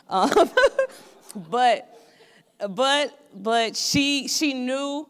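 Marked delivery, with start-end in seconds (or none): 1.5-2.0 loud high burst of laughter. Real, not acted. Laughs at own joke.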